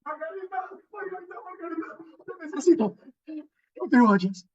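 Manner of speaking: high pleading call growing loud and close; moving from far to near. Shown as a demonstration. Teacher demos a call moving from third to first plane. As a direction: come from the back plane right up to the mic. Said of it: "Como de tercer a primer plano".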